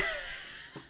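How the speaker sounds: high, soft run of giggles; high laughter. Real, not acted. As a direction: crack up in a long high giggle. Cracks up at own joke.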